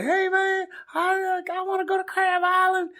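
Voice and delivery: very high-pitched caricature of a Kentucky man; hammed-up excited country caller. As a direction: put on an eager, high Kentucky twang. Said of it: "he's from Kentucky".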